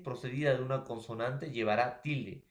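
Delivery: ordinary talk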